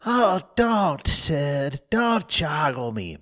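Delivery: milk the pain, whining; moaning. Acted. Tom faking illness to Sid, moaning. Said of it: "Tom moaned out".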